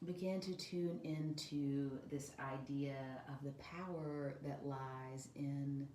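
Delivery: soft, unhurried yoga nidra voice with long pauses; guided meditation. Acted.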